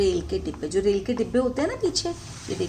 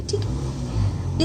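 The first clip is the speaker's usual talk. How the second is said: whisper; much slower than usual. Real, not acted. Teacher wraps up aside.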